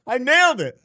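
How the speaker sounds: crowing that the guess was right; laughing triumph. Real, not acted. Streamer to chat, gleeful laughing triumph.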